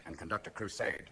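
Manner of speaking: very low, soft mock-president; subdued imitation of an unprepared leader; George W. Bush. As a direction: murmur a folksy pledge deep and quiet. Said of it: "He said".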